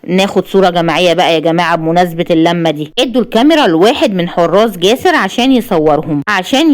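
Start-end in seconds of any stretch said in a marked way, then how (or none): none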